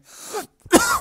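loud high-pitched choking cough. Real, not acted. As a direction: hack it out, still choking. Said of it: "I'm choking".

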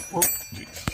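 surprised breathy yelp; startled whoop. Real, not acted. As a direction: caught off guard. Something slips unexpectedly.

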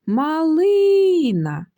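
"Малина" called high and loud, with a long held "ли"; calling out. Shown as a demonstration. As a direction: call "Малина", stretching the middle "ли". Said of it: "треба покликати цю малину".

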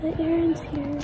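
loopy, drowsy post-surgery voice; groggy from anesthesia. Real, not acted. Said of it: "I'm still loopy from anesthesia".

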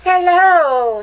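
high, loud hello into the dark; a startled call to whoever is there. A narrator's reading. Greeting in the dark.

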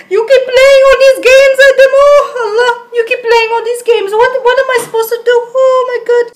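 high-pitched melodramatic wail; tearful, hammed-up pleading; anguished complaint. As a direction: wail at a man who betrayed you. Pleads with Adam, feeling betrayed.

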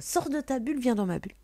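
mock intruder. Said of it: "c'est genre".

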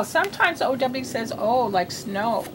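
usual speaking voice

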